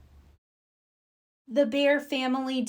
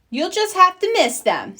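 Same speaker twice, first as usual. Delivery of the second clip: firm motherly no, pitched higher; 10 semitones higher, 4 dB louder than usual. A narrator's reading. Mama firmly refusing the cubs, firm and higher. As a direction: lay down the rule as Mama. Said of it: "said Mama firmly".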